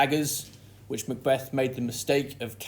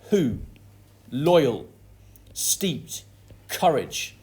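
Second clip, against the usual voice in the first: Who, loyal, courage hit louder, steeped breathed out; stressed syllables; 4 dB louder, much slower than usual. Shown as a demonstration. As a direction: punch each opening word. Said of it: "at the start of some lines we get stressed syllables".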